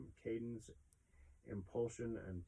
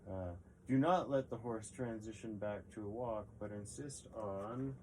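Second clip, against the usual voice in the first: ordinary delivery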